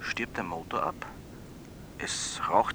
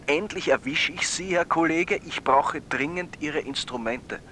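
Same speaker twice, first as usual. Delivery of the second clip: hasty; 6 semitones higher than usual. A narrator's reading. Surgeon hurriedly grabbing the student, urgent and hasty. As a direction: rush the plea like he's out of time. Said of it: "sagt er ganz hastig".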